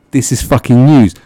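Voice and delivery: sarcastic shout. Real, not acted. Ranting at The Mirror, sarcastic loud shout. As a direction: blast the word news in mock outrage. Mocking the tabloid's news claim.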